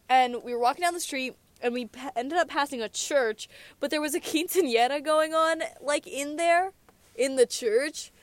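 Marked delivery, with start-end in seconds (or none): 3.8-8.1 slightly higher, put-on Chad voice; lightly performed Chad voice. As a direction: put on your Chad persona. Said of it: "is my Chad voice".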